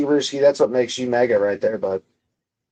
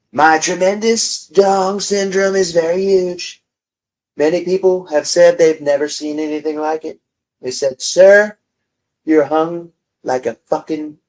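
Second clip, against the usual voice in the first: Trump impression; 3 semitones higher than usual. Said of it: "Got the tone down".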